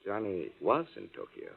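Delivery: "was" stressed with a falling tune. Acted. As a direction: hit the word "was" to correct her. Corrects is to was.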